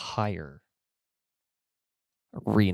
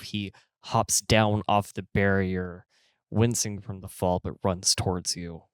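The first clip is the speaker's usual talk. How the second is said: ordinary delivery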